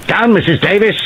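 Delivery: sharp high shouted warning. A narrator's reading. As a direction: yell the warning across the room. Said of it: "A voice shouted".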